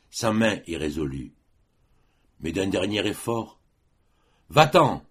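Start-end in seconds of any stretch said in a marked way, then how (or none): 4.5-5.0 loud, high, commanding cry; high, forceful dismissal; cried out. A narrator's reading. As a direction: cry it out sharply to send someone away. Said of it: "lui cria-t-il".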